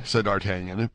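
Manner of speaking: plain reading voice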